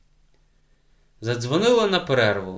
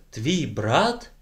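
changed voice. A narrator's reading. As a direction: voice suddenly altered, stunned. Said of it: "Голос вчительки невпізнанно змінився". (голос невпізнанно змінився)

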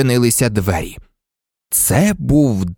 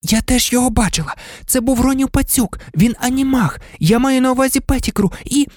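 put-on high, quick, insistent girl's voice; pleading witness in a high pitch; earnest girl; 12 semitones higher, faster than usual. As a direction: explain urgently before being cut off.

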